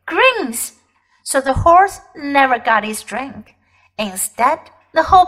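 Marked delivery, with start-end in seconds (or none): 0.1-0.6 urgent. Acted. Polly urges escape.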